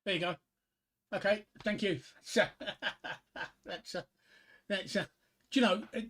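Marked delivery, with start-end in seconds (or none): 2.5-3.6 breathy unvoiced chuckle. Real, not acted. Comic after his own bad joke, chuckling. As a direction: chuckle to yourself.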